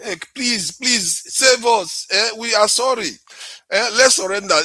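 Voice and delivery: high pleading mock voice; mocking Nigerians begging. Nigerians mocked as begging the enemy, high pleading. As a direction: whine for mercy, hammed-up. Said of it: "You will see them, they'll be twerking".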